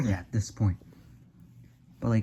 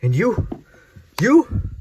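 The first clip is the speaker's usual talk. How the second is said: loud, sharply higher call; shouting; 10 semitones higher, 6 dB louder, much slower than usual. Acted. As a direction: call him out loudly. Calling out to friend.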